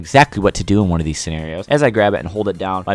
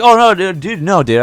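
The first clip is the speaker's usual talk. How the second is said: much higher and louder, caught-out panic; panicked denial; 11 semitones higher, 6 dB louder than usual. Retold or imitated. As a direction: blurt it out like you got caught. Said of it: "I was like".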